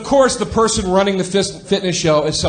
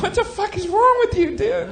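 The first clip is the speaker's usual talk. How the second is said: high, incredulous yelp; a friend shocked at the outburst. Retold or imitated. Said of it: "he was like".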